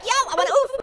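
high, eager mock request; eager soundboard user. Asking for a sound at the soundboard, eager. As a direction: pipe up like a keen customer. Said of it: "be like".

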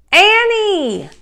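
high, loud name call. A narrator's reading. Jack calling after Annie on the hilltop, loud call. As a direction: call after someone who isn't listening.

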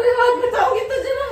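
laughing. Real, not acted. Laughing threat to friend.

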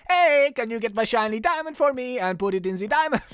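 high, hammed-up fake Mexican accent; bad Mexican accent. Rocky demanding a diamond from the detective, bad Mexican accent. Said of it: "Or maybe, like, Mexican".

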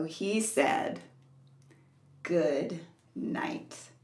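put-on gentle read-aloud for small children; story reading. A narrator's reading. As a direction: read it like a bedtime book. Reads a bedtime story aloud.

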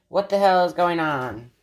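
usual speaking voice

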